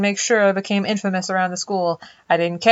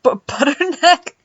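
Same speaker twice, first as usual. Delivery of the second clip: slow, higher, disbelieving echo; baffled; 8 semitones higher, slower than usual. Real, not acted. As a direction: repeat it in disbelief. Baffled by partner's word.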